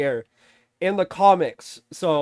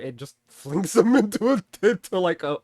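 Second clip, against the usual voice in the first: normal talking voice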